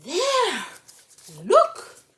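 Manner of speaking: high, loud exclamation; excited calling out. Acted. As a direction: point someone out in an excited cry. Acted onlookers in story.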